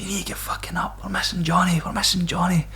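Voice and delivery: high, urgent half-whispered call; urgent hushed call. Retold or imitated. To his mates behind the doors, a high urgent hiss. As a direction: rouse them without waking the whole block. Waking mates after the bar fight.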